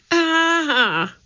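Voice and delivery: very high, held "ah" of fright; narrator's high-voiced startled yelp; startled cry. A narrator's reading. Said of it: "he cried".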